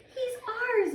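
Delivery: put-on high, thrilled little girl's claim; excited announcement. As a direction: announce it like she just picked him. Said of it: "May announced".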